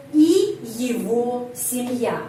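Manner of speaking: и его семья read out slowly, each syllable clear; slow, careful Russian pronunciation; slow Russian demo. Shown as a demonstration. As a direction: model the Russian phrase slowly for learners. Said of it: "penekanannya pada huruf E".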